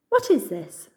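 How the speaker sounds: sharp, alarmed question; startled, wary demand. A narrator's reading. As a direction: bristle with alarm. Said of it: "bristling with alarm".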